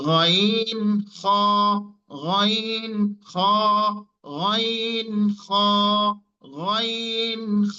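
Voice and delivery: গইন and খ rasped from the top of the throat, each held long; Arabic ghain and kha drills. Shown as a demonstration. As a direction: repeat গইন, খ slowly from the upper throat. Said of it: "কণ্ঠের শেষ ভাগ থেকে উচ্চারণ হয়".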